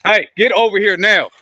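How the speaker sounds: loud high yell across the yard; calling from far away. Real, not acted. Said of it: "Y'all forgive me for yelling".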